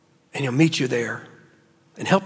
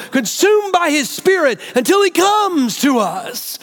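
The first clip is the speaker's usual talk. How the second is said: fervent preaching; 9 semitones higher than usual. Retold or imitated. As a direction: preach it like a flame burning upward. Rabbi's fervent words, preached.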